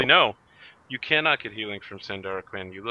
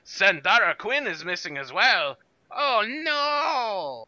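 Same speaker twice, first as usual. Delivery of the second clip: high, hammed-up panicked scream; 11 semitones higher, 10 dB louder, slower than usual. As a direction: shriek it across the ship. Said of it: "He starts screaming".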